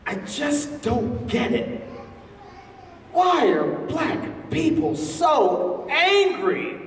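high, slow puzzled woman's voice; imitating the lady. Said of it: "She said".

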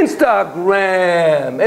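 Instagram stretched very slowly with a rising tune; slow repeat. Acted. Lecturer to audience, repeating the answer slowly. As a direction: draw Instagram out, savouring it. Answering his own question.

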